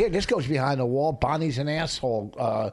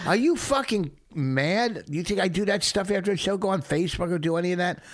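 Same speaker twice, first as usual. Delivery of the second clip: indignant; 7 semitones higher than usual. Real, not acted. Indignant rant at cohost.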